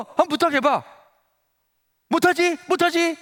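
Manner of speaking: high, jeering schoolboy taunt; goading. Retold or imitated. Classmate daring a believer to pray, jeering. Friend goads him to pray.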